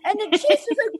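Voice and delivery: ordinary delivery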